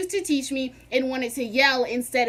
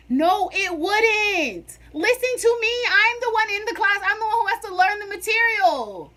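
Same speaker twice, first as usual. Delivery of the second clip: heated; 8 semitones higher, 4 dB louder than usual. Retold or imitated. Student arguing with the schedule lady, heated and loud. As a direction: argue back hard, fired up. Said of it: "I was heated".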